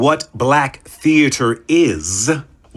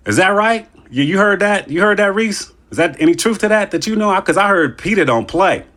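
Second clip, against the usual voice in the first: mock surprise; 7 semitones higher than usual. Mock-asking co-host about peta.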